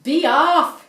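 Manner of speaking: loud, high, hissing command. A narrator's reading. Missus Swan drives off the strange bird, loud hiss. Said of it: "hissed she".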